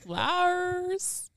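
high, very drawn-out name announcement; mock host announcement. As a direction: stretch the name like a hammy announcer. Hammed-up introduction of the host.

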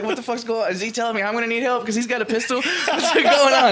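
high, loud confused outburst breaking into laughter; mock bewilderment. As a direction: exclaim in comic confusion. Acting out confusion at garbled plea.

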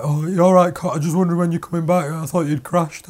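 mock anxious caller; 1 imitating worried dad. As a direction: mimic a fussing stepdad on the phone. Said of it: "he's always, like, ringing my mum up".

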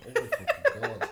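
high breathless exclamation; shocked. Real, not acted. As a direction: gasp it out in disbelief. Catches own slip of tongue.

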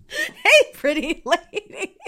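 high, slow, drawn-out fake Southern accent; faux Southern accent. Imitating a come-on to Sebastian, faux Southern accent. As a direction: put on a silly high Southern drawl. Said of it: "In that stupid faux Southern accent".

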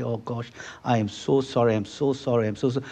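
soft, hushed, apologetic sorry; sheepish apology. Retold or imitated. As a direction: mumble the apology like a guilty driver. Apologizing after crashing Brian's car.

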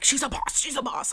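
breathless excited chant; breathless gushing. Retold or imitated. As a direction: gush about her until out of breath. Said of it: "I'm, like, out of breath".

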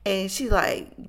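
usual speaking voice

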